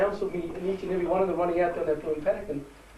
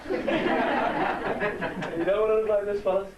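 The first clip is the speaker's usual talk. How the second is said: laughing through the words, slow; real laughter while joking at himself; 3 semitones higher, much slower than usual. Real, not acted. Joke about own looks.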